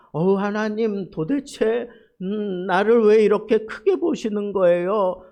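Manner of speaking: loud, high cry of overwhelmed wonder to God; awed exclamation. Shown as a demonstration. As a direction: exclaim it as if moved to tears by grace. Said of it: "너무 감격스러워서 감탄해서 하는 표현". (감격에 겨운 감탄)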